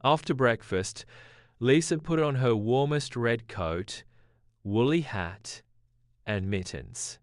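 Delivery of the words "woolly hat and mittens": usual speaking voice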